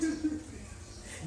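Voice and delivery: airy unvoiced giggle; soft nervous laugh; breathy nervous laugh. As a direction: giggle nervously before a confession. Watchman nervous before confession.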